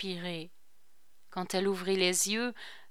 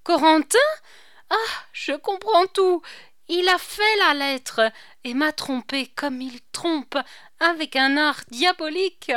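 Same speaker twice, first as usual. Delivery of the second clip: high, loud and shaken; outraged cry; 7 semitones higher, 7 dB louder than usual. A narrator's reading. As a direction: burst out in betrayed fury. Marie's outburst on realizing betrayal. (cri indigné, plus aigu et plus fort)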